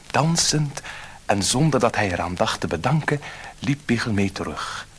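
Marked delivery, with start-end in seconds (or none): none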